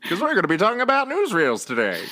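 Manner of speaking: high-pitched announcer voice; old newsreel announcer. Pretend. Host introducing today's topic to listeners, newsreel voice. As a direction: proclaim it like a newsreel narrator. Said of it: "I do better voices than you".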